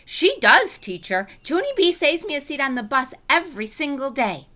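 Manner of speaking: high, loud answering call; shouted back. Acted. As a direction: call back across the room. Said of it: "That Grace shouted back".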